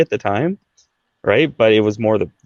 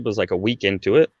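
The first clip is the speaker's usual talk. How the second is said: usual speaking voice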